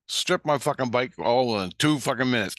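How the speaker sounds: ordinary talk, nothing marked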